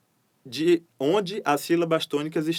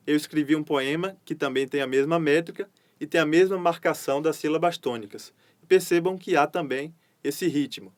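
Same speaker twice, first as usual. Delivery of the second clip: ordinary delivery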